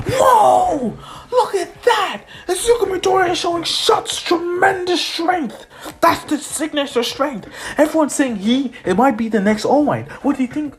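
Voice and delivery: very high, hyped play-by-play; acted stadium announcer in a frenzy; excited commentator. Announcer hypes the race.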